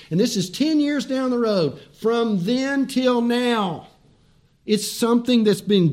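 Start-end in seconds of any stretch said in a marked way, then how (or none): 2.0-3.9 slow emphasis. Acted. Preacher drawing out key phrase.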